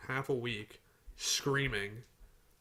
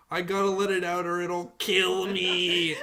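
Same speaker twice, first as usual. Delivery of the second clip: slow, higher mock wail, cracking up while imitating; mock sobbing; 4 semitones higher, 4 dB louder, slower than usual. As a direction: imitate a bawling friend but laugh through it. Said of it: "She's still fucking bawling".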